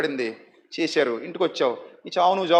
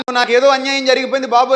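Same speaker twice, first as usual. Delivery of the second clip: head-beating lament; 5 semitones higher, 5 dB louder than usual. Retold or imitated. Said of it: "అని తల బాదుకుంటే".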